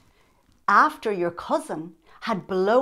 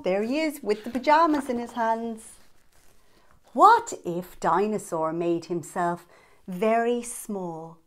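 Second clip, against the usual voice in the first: ordinary delivery, nothing marked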